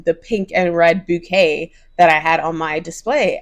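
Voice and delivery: ordinary delivery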